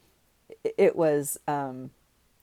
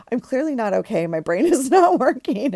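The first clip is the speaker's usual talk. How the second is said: laughing; 7 semitones higher, 5 dB louder than usual. Real, not acted. Laughing at herself.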